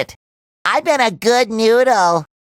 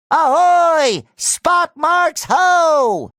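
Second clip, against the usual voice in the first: long, drawn-out high holler; sailor's lookout call; 5 semitones higher, much slower than usual. Acted.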